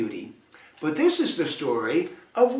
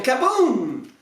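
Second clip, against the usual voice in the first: higher mock explosion; cannon boom; 8 semitones higher than usual. A narrator's reading. Narrator sounding the cannon blast.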